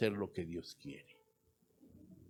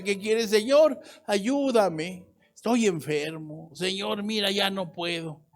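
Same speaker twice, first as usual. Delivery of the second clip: high-pitched begging for help; acted desperate plea; 8 semitones higher, slower than usual. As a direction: plead with God as someone worn down. Sick believer pleads for help. (súplica angustiada, en voz aguda)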